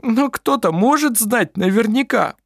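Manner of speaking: high, sobbing plea. A narrator's reading. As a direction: say it through a sob. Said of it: "всхлипнул Клампин".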